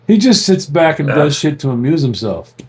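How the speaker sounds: ordinary delivery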